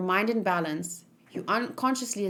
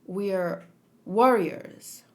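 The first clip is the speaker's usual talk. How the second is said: slow reveal; much slower than usual. Acted. Reiner's reveal.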